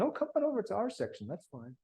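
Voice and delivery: quick, high mock-cheerful call; breezy British invite. British officers still inviting the Americans over, quick high mock cheer. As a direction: rattle off a lightly voiced easy welcome. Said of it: "the British are still saying".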